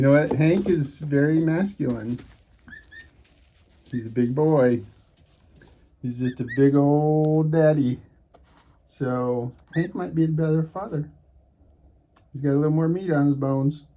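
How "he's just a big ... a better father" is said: ordinary delivery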